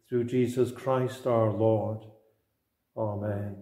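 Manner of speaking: normal talking voice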